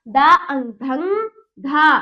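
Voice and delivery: a teacher modelling slow blending for the class; slow sounding out. Shown as a demonstration. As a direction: stretch दा and अंग before landing on धंधा. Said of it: "मेरे साथ बोलिए".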